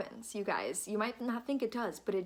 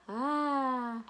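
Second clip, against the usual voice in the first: put-on lightly higher cooing sound to a baby; baby babble; 4 semitones higher, 4 dB louder than usual. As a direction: coo at the baby like mom does. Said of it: "sit with her and go".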